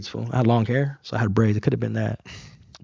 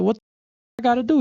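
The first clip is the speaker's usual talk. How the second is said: indignant disbelief; 9 semitones higher than usual. Retold or imitated. Retelling his outrage at the cop.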